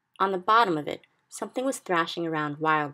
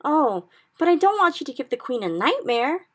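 high, louder pleading protest; crying out; 9 semitones higher, 7 dB louder than usual. A narrator's reading. Sophie cries out pleading with the giant, high and loud. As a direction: beg him not to do it. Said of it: "Sophie cried".

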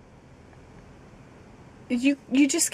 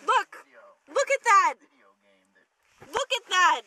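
excited; 6 semitones higher, 4 dB quieter than usual. Real, not acted. Showing the hole in jeans.

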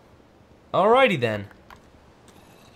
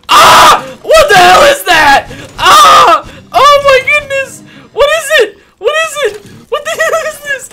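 high, loud panicked screams; terrified screaming; 20 dB louder than usual. Real, not acted. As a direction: scream in sheer terror. Terrified by strange monster.

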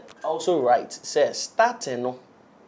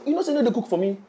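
imitating a wife; 9 semitones higher, faster than usual. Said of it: "then you say".

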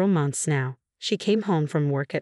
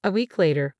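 ordinary delivery, nothing marked

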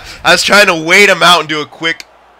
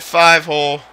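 usual speaking voice

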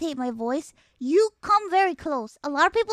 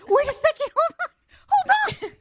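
excited disbelief; 11 semitones higher, 3 dB louder than usual. Real, not acted. Overwhelmed by the impressions.

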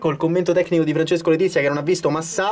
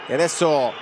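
live match commentary; 3 semitones higher, 3 dB quieter than usual. Acted. Penalty call commentary.

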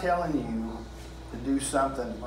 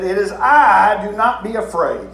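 booming Jesus voice; 5 semitones higher, 9 dB louder than usual. Acted. Preacher voicing Jesus to the disciples, booming. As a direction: call out over the wind as Jesus.

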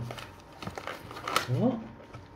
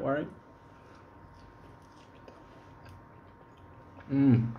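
talking while chewing; mouth full of fries. Real, not acted. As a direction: between bites. Seen 3: Fry put in mouth just before; mouth works while speaking.

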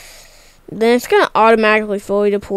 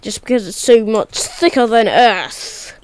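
ordinary talk, nothing marked

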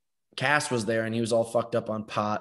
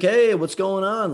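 higher, louder and slow, a neighbour calling out a friendly hello; friendly curious greeting; 8 semitones higher, 6 dB louder than usual. Retold or imitated. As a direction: hail the newcomer cheerfully from the car. Townsperson pulling over.

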